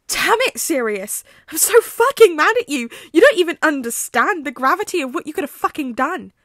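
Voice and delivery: furious shouting. Acted. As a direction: tear into him, then break off. Remus explodes at Sirius.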